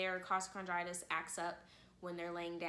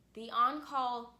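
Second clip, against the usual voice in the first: ordinary delivery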